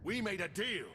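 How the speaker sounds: high, indignant outburst; angry protest. Acted. Crime boss protests the broken deal to the envoy. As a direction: protest the betrayal hotly.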